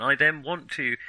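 usual speaking voice

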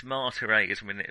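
ordinary delivery

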